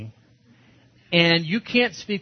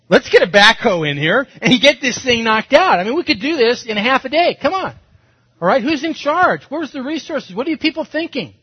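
higher and louder, building impatience; exasperated mock rant; 8 semitones higher, 8 dB louder than usual. Pastor replaying his frustration at digging by hand, mock exasperated rant. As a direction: fume like the boss who wants a backhoe now. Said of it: "So I'm thinking".

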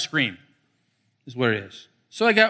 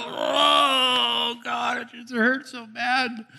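mock groaning prayer; 6 semitones higher, much slower than usual. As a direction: groan it out like a hurting pray-er. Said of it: "Just go in and go".

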